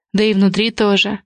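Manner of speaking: plain reading voice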